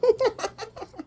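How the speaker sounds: laugh. Real, not acted. Laughs at the cards.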